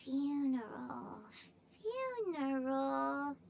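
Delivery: slow, low, spooky echo of the word; drawn out low and grim; low ominous repeat. Acted. Paimon echoing the word in a spooky low voice.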